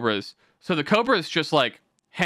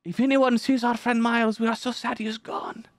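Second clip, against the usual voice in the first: high, put-on voice for a pleading post; mock plea for a missing friend, pitched up; Taliban account voice; 9 semitones higher than usual. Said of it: "the official Taliban, like, Twitter account".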